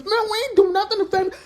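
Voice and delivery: high, fast panicky voice; mock scared squeal at gunpoint; panicked denial. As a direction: beg her to believe you, rushed. Captives denying at gunpoint.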